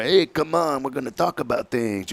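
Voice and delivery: corner-table guy. Retold or imitated. Guy inviting someone to the back table, lightly imitated. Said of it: "The guy sounded like he was like that guy".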